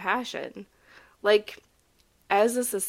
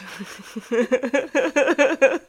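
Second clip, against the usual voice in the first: laughing; 6 semitones higher, 6 dB louder than usual. Real, not acted. Laughs at her joke.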